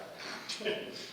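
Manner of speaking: a quiet, pleased little laugh; chuckle, proud of yourself; soft self-satisfied chuckle. Acted. Proud of his speech to the audience, soft chuckle.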